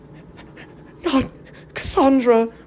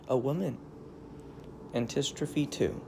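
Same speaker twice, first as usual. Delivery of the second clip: usual speaking voice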